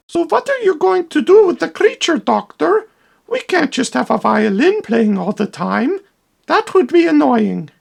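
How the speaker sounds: high alarmed assistant voice; frantic assistant. Acted. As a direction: cry out the news like a panicky little helper. The assistant asks the doctor.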